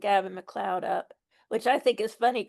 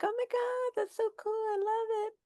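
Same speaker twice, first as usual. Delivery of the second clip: high-pitched fangirl gush; gushing excitement; 12 semitones higher, 4 dB quieter than usual. Retold or imitated. Reacting to a World War Two ship, high excited gushing. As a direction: squeal it softly, way up high.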